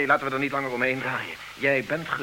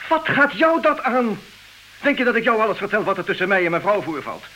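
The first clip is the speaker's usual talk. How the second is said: furious outburst; 7 semitones higher, 7 dB louder than usual. Acted. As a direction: let it burst out at him, full of rage. Husband explodes at nosy friend.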